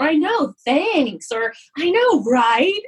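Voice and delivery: high, slow, bright "I know, right?"; breezy proud acceptance. Retold or imitated. Robin happily accepting praise, high and unabashed. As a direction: lift it up and own the compliment.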